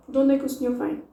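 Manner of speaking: quick, words run together; De onde é que o senhor vem as one fast chunk; fast native speed. Shown as a demonstration. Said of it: "That was fast".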